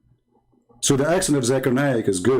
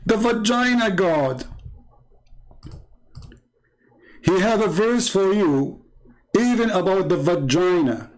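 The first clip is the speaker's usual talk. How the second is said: mocking; 7 semitones higher than usual. Mocking the vagina verse.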